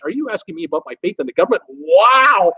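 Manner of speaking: ordinary talk, nothing marked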